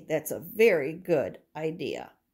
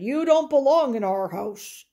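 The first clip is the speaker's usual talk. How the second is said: Papa Bear; 6 semitones higher, 7 dB louder than usual. Acted. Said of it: "Papa Bear said".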